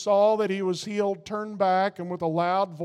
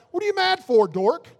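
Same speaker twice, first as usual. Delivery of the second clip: mock self scolding; 8 semitones higher than usual. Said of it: "and I'm like".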